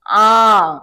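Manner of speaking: आ held long and a bit loud; sound demo. Shown as a demonstration. Teacher sounding आ for the class. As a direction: sing out आ long and clear. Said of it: "आ की मात्रा".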